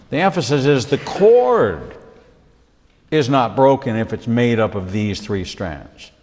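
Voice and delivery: ordinary talk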